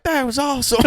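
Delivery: high-pitched mock Southern kid; put-on Southern voice. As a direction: imitate an impressed Southern guy. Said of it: "whenever you do that voice".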